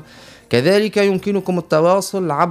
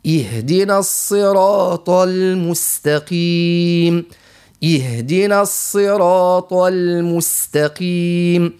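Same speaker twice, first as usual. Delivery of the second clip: slow, drawn-out recitation; Quran recitation; 2 semitones higher, much slower than usual. Shown as a demonstration. Teacher finishes the Fatiha, slow and measured. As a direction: recite the closing verses with full tajwid.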